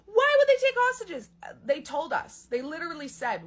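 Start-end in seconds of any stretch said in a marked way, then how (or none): 0.1-1.3 much higher, louder mocking whine; mocking outraged people. Comic mocking critics who question the hostages, high whine. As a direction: parody the indignant critics. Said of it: "people are going".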